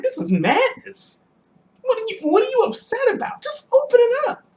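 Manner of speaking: retold exasperated outburst at his wife; exasperated high yell. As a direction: yell it like you can't believe she's upset. Exasperated at wife getting upset.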